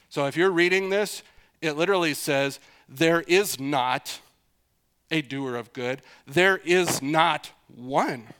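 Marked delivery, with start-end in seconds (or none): 2.9-8.3 "There is not" slow and loud, each word punched; very emphatic. Shown as a demonstration. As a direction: hammer "is not", pause before "a doer of good". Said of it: "Very emphatic, very emphatic".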